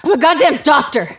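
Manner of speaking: indignant retort. Acted. Correcting the guard who called her miss, indignant. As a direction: bite back, offended.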